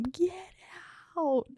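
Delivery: high-pitched, drawn-out mock yell; held-back mock scream, stretched out; retold yell. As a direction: imitate an embarrassed outburst without real volume. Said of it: "yells at him".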